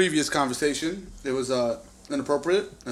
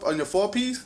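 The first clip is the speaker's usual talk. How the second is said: ordinary talk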